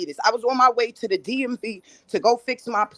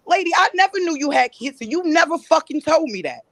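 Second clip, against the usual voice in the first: higher-pitched, heated denial; angry accusation; 5 semitones higher than usual. Real, not acted. Guest addressing the woman who accused her, angry accusation. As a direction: confront her as if she were here.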